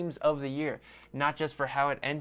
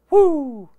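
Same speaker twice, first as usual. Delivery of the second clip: much higher, louder cheering whoop; celebratory woo; 13 semitones higher, 12 dB louder than usual. Real, not acted. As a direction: cheer like your team just won. Celebrating Ravens division win.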